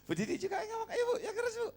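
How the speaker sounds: high laughing urging; giggling encouragement to speak up. Real, not acted. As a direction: coax her through laughter.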